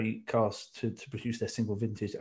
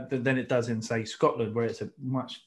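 ordinary talk, nothing marked

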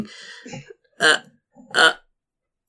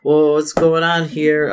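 higher, slow, awkward laughing; nervous fake laugh; 6 semitones higher, 4 dB louder, slower than usual. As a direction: laugh it off nervously then back away. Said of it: "Jake's like".

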